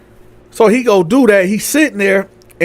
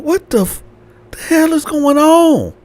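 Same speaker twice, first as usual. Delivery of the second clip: high-pitched exasperated question; mock bewilderment; 10 semitones higher than usual. Landlord hearing the fight outside, bewildered. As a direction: play a baffled man hearing commotion.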